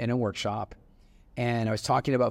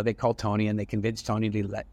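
ordinary delivery

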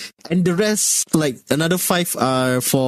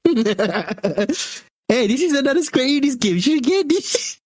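higher-pitched salesman pitch; pushy shop seller; 7 semitones higher, slower than usual. Acted. Shop seller upselling Babylon's Fall to a customer, pushy pitch. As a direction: play a seller pushing another game.

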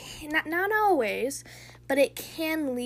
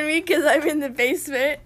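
laughing; 2 semitones higher, 7 dB louder than usual. Real, not acted. Laughing after interruption.

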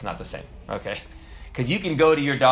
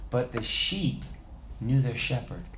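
ordinary talk, nothing marked

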